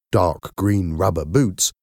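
plain reading voice

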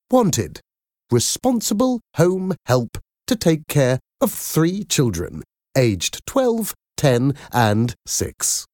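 lightly voiced mother reading the advert; advert read aloud. Miranda reads her newspaper advert.

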